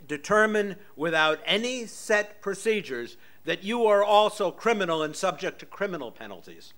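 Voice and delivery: usual speaking voice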